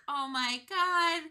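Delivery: high, loud, doting gush; doting old lady. Retold or imitated. Betty greeting Sandra, high gushing doting voice. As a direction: gush over her like a sweet grandma. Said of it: "and Betty is like".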